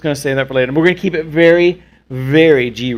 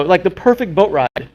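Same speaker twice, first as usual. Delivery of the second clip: ordinary delivery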